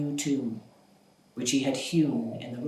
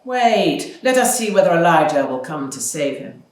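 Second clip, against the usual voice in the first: mocking wait; 2 semitones higher, 8 dB louder than usual. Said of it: "But the others said".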